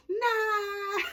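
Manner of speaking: high, loud, drawn-out no; mock group refusal. Everybody turns down the celibacy idea, loud mock refusal. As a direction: imitate everybody hollering it, way up high.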